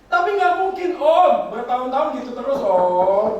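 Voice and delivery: loud preaching shout. Real, not acted. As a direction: shout it at the congregation. (teriakan keras berkhotbah)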